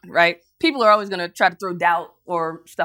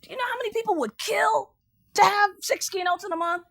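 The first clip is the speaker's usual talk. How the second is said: high incredulous what, then pressing; incredulous mentor; 11 semitones higher than usual. Retold or imitated. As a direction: mentor can't believe it. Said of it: "He said".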